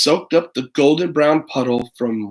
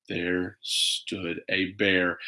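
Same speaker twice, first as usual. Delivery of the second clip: ominous reveal; 7 semitones lower, 9 dB quieter, much slower than usual. A narrator's reading. Dramatic reveal in story narration.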